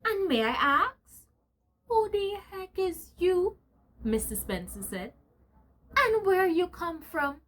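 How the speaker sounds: high, sharp challenge; indignant challenge. Acted. Mister Spencer confronting Bulldog, high and affronted. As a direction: demand it, affronted.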